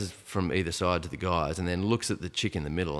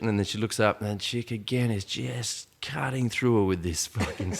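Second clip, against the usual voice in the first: ordinary talk, nothing marked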